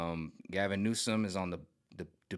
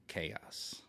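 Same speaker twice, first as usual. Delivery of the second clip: whispered chaos; 3 semitones lower, 11 dB quieter than usual. Real, not acted. Stressing controlled chaos.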